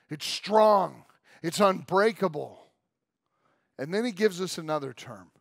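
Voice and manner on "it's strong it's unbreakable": louder, higher, building proclamation; full-force preaching. Acted. Preacher to congregation about everlasting God, proclaiming. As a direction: preach it to the back row.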